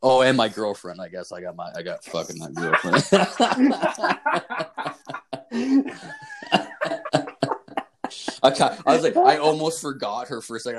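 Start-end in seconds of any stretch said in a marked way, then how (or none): 3.1-8.4 long, high, helpless laugh at himself. Real, not acted. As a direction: cracks up at his own slip, high and long. Nearly forgot his girlfriend.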